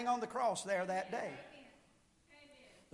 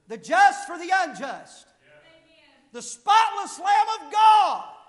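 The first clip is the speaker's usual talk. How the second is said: preaching at full force; 5 semitones higher, 10 dB louder than usual. Acted. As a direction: proclaim it to the whole church. Preaching climax on Christ's purity.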